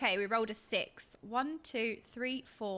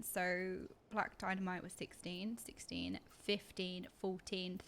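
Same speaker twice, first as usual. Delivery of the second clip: whispered counting; 4 semitones lower, 9 dB quieter than usual. Real, not acted. Counting rolls under breath.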